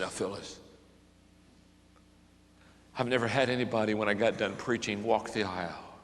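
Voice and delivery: soft, low confiding voice; preacher confiding softly to a new believer; hushed retelling. Retold or imitated. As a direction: keep it soft and secret. Quiet talk with convert.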